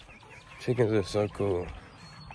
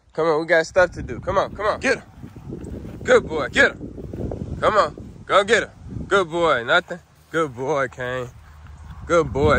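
dog commands; 6 semitones higher than usual. Real, not acted. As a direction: urge the dog on, then praise him.

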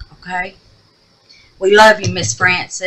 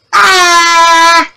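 bright, loud sign-off yell, much higher; shouted goodbye; 10 semitones higher, 7 dB louder than usual. Real, not acted. Vlogger yelling bye to her babies, loud and high. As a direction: call out goodbye to the viewers. Signing off to viewers.

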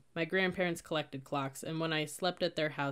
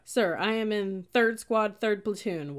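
loud, high, slow recruit's answer; shouted report; 4 semitones higher, 6 dB louder, slower than usual. A narrator's reading. Cadet answers sergeant's squad question, shouting. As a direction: shout each unit out stiffly. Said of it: "enough for me to shout".

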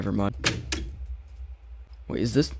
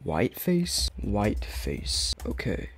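ordinary talk

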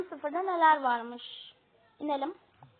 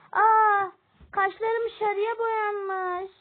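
yüksek, yavaş, şaşkın bir çığlık; kaşlarını görünce şaşırarak söyle; 7 semitones higher, 8 dB louder, much slower than usual. Acted. (kaşları sarıya boyanan abinin şaşkınlığı)

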